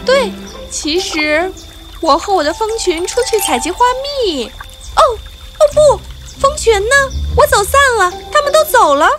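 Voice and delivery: high, rising alarm; panicked little bee. Acted. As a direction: realize the swarm is gone. Maya realizes she is lost.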